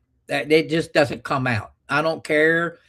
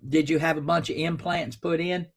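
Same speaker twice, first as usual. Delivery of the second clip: ordinary talk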